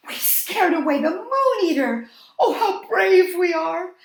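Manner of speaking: high, loud, laughing boast; laughing triumph. A narrator's reading. Night creatures boasting of their bravery, laughing and loud. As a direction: crow with delight, high and loud.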